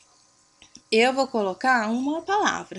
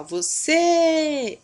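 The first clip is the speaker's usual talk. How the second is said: "você" with CÊ held long, high and loud; stressed last syllable; 5 semitones higher, 6 dB louder than usual. Shown as a demonstration. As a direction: stretch out the final "cê" of "você". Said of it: "é a palavra que se estende".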